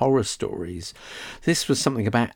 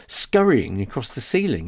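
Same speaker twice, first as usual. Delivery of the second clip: ordinary delivery, nothing marked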